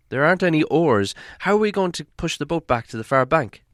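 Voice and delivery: high, loud worried hobbit; hobbit asking. Acted. Hobbit to the dwarves about the oars, high worried. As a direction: ask anxiously. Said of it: "asked the hobbit".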